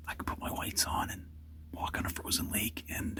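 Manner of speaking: whispering while shivering from cold. Real, not acted. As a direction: talk through the shivers of the cold. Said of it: "sorry, I'm shivering right now".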